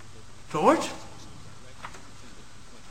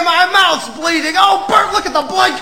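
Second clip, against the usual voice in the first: ordinary talk, nothing marked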